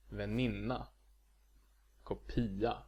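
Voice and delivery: Väninna, kopia, stressed on the second-to-last syllable; penultimate stress. Shown as a demonstration. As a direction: lean on NIN in Väninna and PI in kopia. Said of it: "stress on the second to last syllable".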